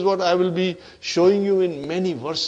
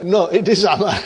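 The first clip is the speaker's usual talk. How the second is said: amused correction. Real, not acted. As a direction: break into a laugh while correcting yourself. Joking about drinking tea.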